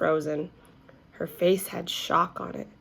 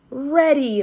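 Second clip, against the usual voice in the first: loud excited holler; 7 semitones higher, 6 dB louder than usual. A narrator's reading. Class hollering back to the teacher, loud and eager. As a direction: call it out to the teacher. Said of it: "hollered room one".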